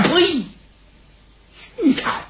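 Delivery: mocking echo, pitch leaping up; scornful spit. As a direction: scoff at the very idea. King spits in scorn.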